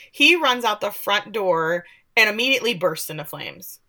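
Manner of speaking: ordinary talk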